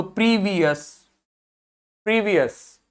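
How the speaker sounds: "प्रीवियस" with long ee sounds and weak last vowel; unstressed schwa ending. Shown as a demonstration. Teacher assembling the word from its syllables, "प्रीवियस" with reduced ending. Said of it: "इसका मतलब आप जोर नहीं देंगे".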